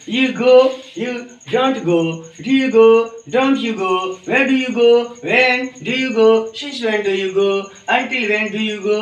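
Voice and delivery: slow, spaced English phrases for students to copy; slow drill pronunciation. Shown as a demonstration. Teacher correcting students' pronunciation, slow and deliberate. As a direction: drill each question slowly and clearly. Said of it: "pronunciation को भी सुधार करेंगे".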